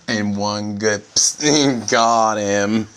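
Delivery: loud, slow pounce sound; mock spider strike. Voicing the spider's kill, loud and slow. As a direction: hit it like a ninja strike.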